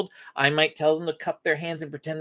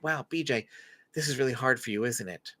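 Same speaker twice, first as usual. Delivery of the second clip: soft, lower, comforting words; quietly name the child's struggle; gentle empathy; 3 semitones lower, 7 dB quieter than usual. Retold or imitated. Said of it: "he says something like".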